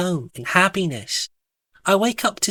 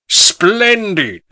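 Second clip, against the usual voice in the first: loud, higher, pompous gentleman; intoned; 5 semitones higher, 7 dB louder than usual. A narrator's reading. Sir Pardon Smith answering Alice at tea, loud intoning. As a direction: intone it grandly. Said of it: "Sir Pardon Smith intones".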